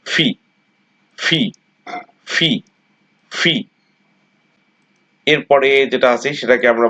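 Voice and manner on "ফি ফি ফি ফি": ফি with aspirated ফ; correct letter name. Shown as a demonstration. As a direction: breathe through ফি, repeated. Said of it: "এটা হচ্ছে ফি". (সঠিক উচ্চারণে ফি)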